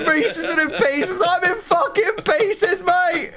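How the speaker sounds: high, crying shout of defeat; despairing wail. Real, not acted. Gamer losing half his squad, wailing and shouting. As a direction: wail like it's all lost.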